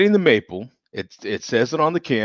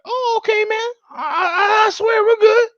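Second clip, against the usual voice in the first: much higher, nervously placating; voice going high. Retold or imitated. Drinker answering the deep-voiced beer in a high scared squeak. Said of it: "you think you're going low".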